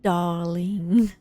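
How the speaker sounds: a gentle hint of a French accent on "Darling."; light French accent. Reading the letter, echoing Anne's French accent on "Darling.". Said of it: "with your lovely French accent".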